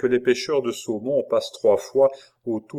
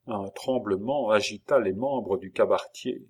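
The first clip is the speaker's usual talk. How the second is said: plain reading voice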